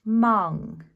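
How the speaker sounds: "mung" said loud and clearly; clear mung demo. Shown as a demonstration. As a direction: say "mung" like a model word. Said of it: "to make".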